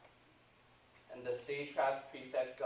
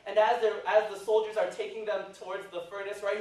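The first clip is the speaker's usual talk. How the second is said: ordinary talk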